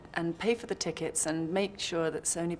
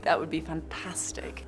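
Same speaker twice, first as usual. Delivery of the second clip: whispered aside. Acted. Joking wish, whispered.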